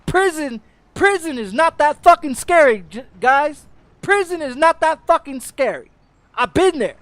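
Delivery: high, loud, defiant shout; ex-con shouting at viewers that prison isn't scary; loud angry insistence. Real, not acted.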